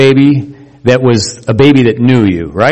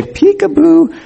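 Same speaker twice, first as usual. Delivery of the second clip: much higher, bright playful peekaboo; happy peekaboo; slower than usual. Shown as a demonstration. Parent reappearing to baby, much higher playful call. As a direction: pop back in to delight the baby. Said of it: "you have to use a happy face".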